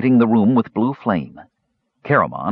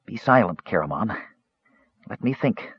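weak, hushed voice; 2 semitones higher, 5 dB quieter than usual. A narrator's reading. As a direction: answer faintly, as if drained. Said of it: "the mage said weakly".

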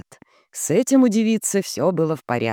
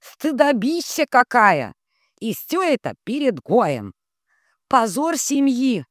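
slushy sh for s; 9 semitones higher than usual. Acted. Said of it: "прозвучал шепелявый голос Нует".

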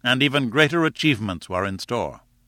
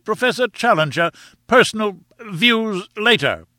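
high, flustered bleat; excited bleating; 9 semitones higher, 4 dB louder than usual. A narrator's reading. Chairman on his feet trying to restore order, bleating. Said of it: "flapping both his hands and bleating excitedly".

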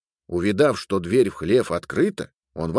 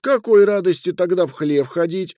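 high, louder voice of a wife; nagging wife; 9 semitones higher, 4 dB louder than usual. Acted. Wife questioning her husband about the barn, high and nagging. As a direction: needle him in a high voice. Катри пристаёт к мужу с вопросами.

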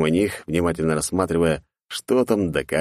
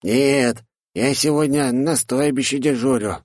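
lazily answering goblin; lazy goblin; 7 semitones higher, slower than usual. Acted. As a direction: drawl it lazily from bed. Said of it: "лениво ответил ему друг". (ленивый гоблин)

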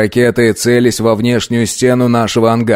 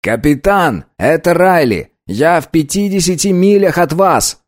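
higher-pitched pilot calling in; radio call; 7 semitones higher than usual. Acted. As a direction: call in urgently over the radio.

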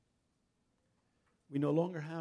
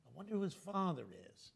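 softer, muttered repeat of I wonder who his father is; fading gossip; 3 semitones higher, 10 dB quieter than usual. Retold or imitated. Gossip echoing in Ben's head, fading to a murmur.

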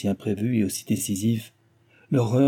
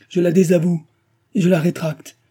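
passionate outburst; 8 semitones higher, 7 dB louder than usual. Acted. Lover retracts a declaration.

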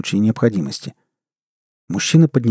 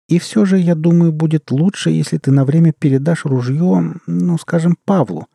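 higher-pitched, insistent woman's voice; firm young woman character; 6 semitones higher than usual. Acted. Sveta telling Egor to hand over the gun, insistent. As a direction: reason with him in a higher voice.

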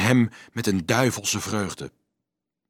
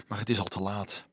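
hushed, much softer voice; whisper; 3 semitones lower, 10 dB quieter than usual. A narrator's reading. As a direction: let it drop to a near whisper. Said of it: "fluisterde de jongen opeens". (zacht, bijna fluisterend)

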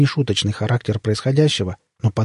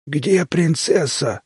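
slow, drawn-out husky demand; higher, hoarse creature drawing out each word; husky alien voice; 5 semitones higher, much slower than usual. A narrator's reading. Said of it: "сиплым хором спросили пришельцы".

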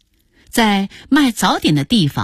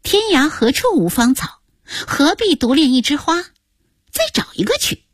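put-on high-pitched child reciting advice; mischievous, rising much higher at the end; cheeky granddaughter; 8 semitones higher than usual. Granddaughter hugs grandpa, urges replacing grandma, cheeky.

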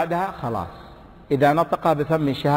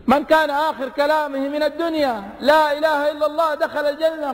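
high, raised preacher's voice; impassioned preaching; 12 semitones higher than usual. Acted. Preacher retelling a young man's question, impassioned and high. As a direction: keep it up and ringing over the crowd.

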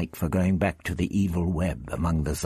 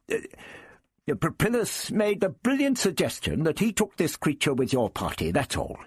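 put-on high, mollified old man; smooth it over, high then easing down; the Doctor, relenting; 11 semitones higher than usual. The Doctor covers up the row for Barbara, relenting.

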